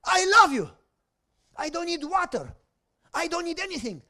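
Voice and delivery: high, rising impassioned worship voice; fervent prayer. Acted. Preacher voicing David's psalm as fervent, high-pitched prayer. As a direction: pour out David's love to God, building.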